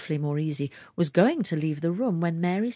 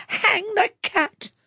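much higher, louder, slow outraged cry; stormy cry; 6 dB louder, slower than usual. A narrator's reading. Landlady raging over the stolen sausages, loud high cry. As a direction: fling it out in a storm of temper. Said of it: "Missus Jenkins stormed and cried".

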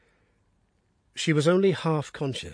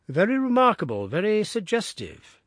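intrigued; 7 semitones higher, 3 dB louder than usual. Acted. Holmes intrigued.

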